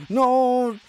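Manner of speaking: high, drawn-out cry; anguished protest. Real, not acted. Player watching the character get hit, dismayed wail.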